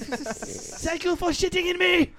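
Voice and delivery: high, slow put-on voice; a strained high character voice as the tortured toilet; attempted Hitler voice. Toilet thanking its user in a high, slow put-on voice. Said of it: "how was that?".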